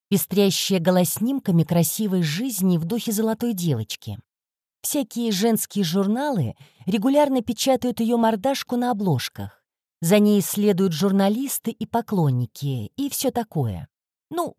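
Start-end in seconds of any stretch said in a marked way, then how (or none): none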